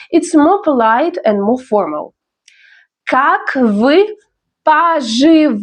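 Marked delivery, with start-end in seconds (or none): 3.0-5.6 Как вы, pause, поживаете syllable by syllable; pronunciation demo. Shown as a demonstration. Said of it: "Let's learn pronunciation".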